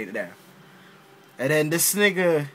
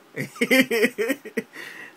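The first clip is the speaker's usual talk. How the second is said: a high laugh bursting out; amused laugh; 6 semitones higher than usual. Real, not acted. Fan laughing at his own Superman quote.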